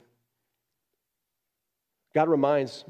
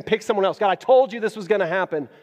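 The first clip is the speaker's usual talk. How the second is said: louder, higher exasperated complaint; exasperated Moses; 7 semitones higher, 8 dB louder than usual. Acted. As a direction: throw up your hands at God. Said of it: "he's basically saying".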